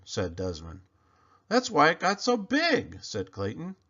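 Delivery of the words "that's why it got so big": higher, louder eureka; excited realization. Acted. Clayton realizes why the pumpkin grew, high and excited. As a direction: exclaim it as the penny drops.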